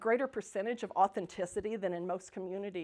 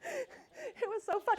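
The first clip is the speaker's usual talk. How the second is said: laughing; 12 semitones higher, 6 dB quieter, much slower than usual. Real, not acted. Laughing while recalling.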